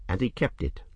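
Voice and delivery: plain reading voice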